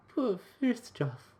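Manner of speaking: high, slow put-on girl voice; muttering girl. A narrator's reading. Girl fussing over her borrowed bicycle, high muttering girl voice. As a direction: draw it out, high and pitying.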